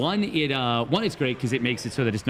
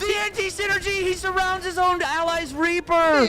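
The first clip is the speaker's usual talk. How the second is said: excited shout. Real, not acted. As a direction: yelp it out as the play goes wrong. Caster reacts to unit disaster.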